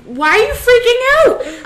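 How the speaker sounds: high, loud incredulous demand; retold exasperation. Sister retelling how she questioned her crying brother, loud exasperation. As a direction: act out a sister's baffled outburst.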